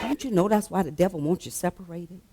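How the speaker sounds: usual speaking voice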